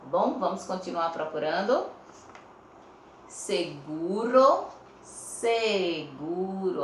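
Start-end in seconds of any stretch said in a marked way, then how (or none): 3.3-4.7 "Seguro" slow and loud, rising at the end; slow word demonstration. Shown as a demonstration. Teacher offering pupils a word to check, slow and rising. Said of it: "Olha o S com o som do S". 5.0-6.8 "seguro" very slow, first syllable stretched, falling; S sound shown. Shown as a demonstration. Teacher letting pupils hear the S, very slowly. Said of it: "Olha o S com o som do S".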